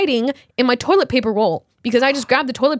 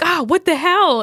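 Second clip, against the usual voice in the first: retold yell; 6 semitones higher, 4 dB louder, slower than usual. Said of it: "it literally made me yell out loud".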